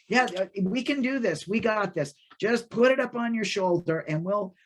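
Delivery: rally your son with mild enthusiasm; eager encouragement. Acted. Old man urges his son on.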